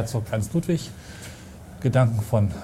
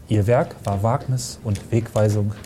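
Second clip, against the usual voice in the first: solemn closing line; 2 semitones lower than usual. Acted. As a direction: read the last line with weight. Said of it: "Schlusssatz bringen". (feierlich vorgetragen)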